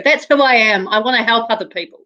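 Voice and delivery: usual speaking voice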